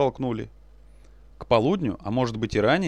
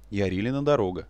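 usual speaking voice

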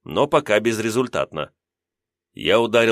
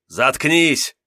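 growled; 5 semitones higher than usual. Acted. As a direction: growl it to silence him. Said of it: "рыкнул я".

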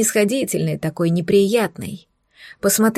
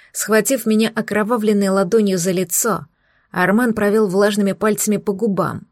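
usual speaking voice

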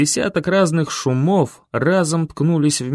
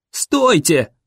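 shouting; 4 semitones higher than usual. A narrator's reading. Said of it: "закричал я".

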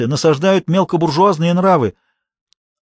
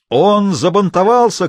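drawn-out, raised mock shout; 5 semitones higher, 4 dB louder, slower than usual. A narrator's reading. Yasha heckles the boy with the bow, a stretched-out shout. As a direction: call it out high and slow, without full force. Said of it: "крикнул Яша".

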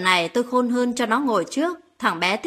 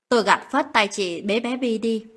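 plain reading voice

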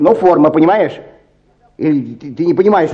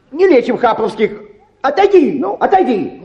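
shooing away; 11 semitones higher than usual. Acted. Clerk shooing away peasant.

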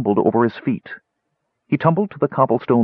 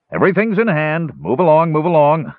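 high, raised crowd-control call; 7 semitones higher, 4 dB louder than usual. A narrator's reading. Guard dispersing curious market crowd, calling out.

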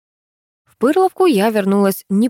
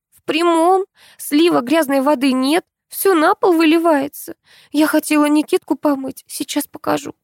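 high-pitched animated woman's voice, explaining a household problem; high, complaining character voice; Tamara, complaining friend; 9 semitones higher than usual. Acted. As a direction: voice a put-out friend in a high register.

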